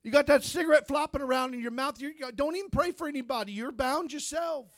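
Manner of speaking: a touch of a scornful father; mock the son's preaching like a doubting dad; lightly voiced scolding father. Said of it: "He said".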